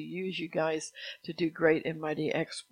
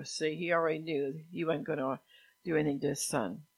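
ordinary talk

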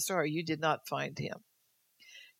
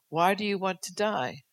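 ordinary talk

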